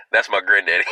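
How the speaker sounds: ordinary talk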